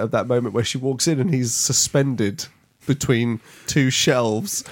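ordinary delivery

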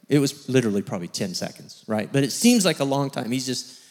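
ordinary talk

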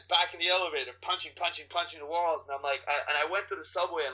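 ordinary talk

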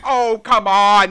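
loud, slow whiny protest; exasperated protest. Real, not acted. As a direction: complain loudly and drawn out. Protesting co-host's objection.

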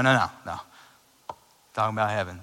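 ordinary talk, nothing marked

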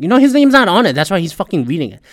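high and loud, explaining to Colleen; exasperated quote. Retold or imitated. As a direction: fire it off, worked up. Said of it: "telling Colleen".

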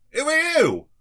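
high, loud shout; yelled question. Acted. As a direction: yell it out at the newcomer. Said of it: "one just yells out".

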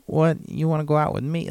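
put-on high, quick New York baby voice; a breathy-free high New Yorker, quick and girlish; New York accent. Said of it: "She kinda talked like this".

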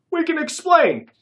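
high loud frightened plea; fearful plea. A narrator's reading. Larger thief pleading with the gunmen, frightened. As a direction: scramble to explain in fear. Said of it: "his voice quivering with fear".

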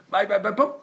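loud, high brushing-off; mock dismissal. Rich brother throwing his humble brother out, loud mock brush-off. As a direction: shoo him out with a bang.

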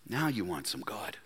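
low, very soft sarcastic aside; hushed scorn. Acted. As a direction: let the reproach drop almost under the breath. Prophet's scornful aside, acted.

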